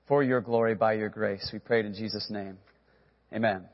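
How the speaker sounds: hushed, reverent prayer voice. Acted. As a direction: pray it gently over the room. Pastor leads a prayer.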